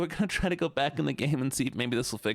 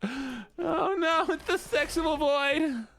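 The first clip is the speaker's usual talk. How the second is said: even higher, slow whine; whiny voice; 11 semitones higher, much slower than usual. Pretend. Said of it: "I need to do the whiny voice".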